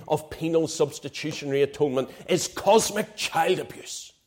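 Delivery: indignant, heated preaching; worked up. Real, not acted. Preacher scorning the cosmic child abuse claim, heated and indignant. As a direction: let real outrage push the words. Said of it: "I'm sorry for getting worked up".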